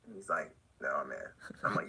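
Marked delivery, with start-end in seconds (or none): 0.8-1.2 much lower, stunned reply. Retold or imitated. Hensley answering the narrator, low and stunned. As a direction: drop low and deadpan.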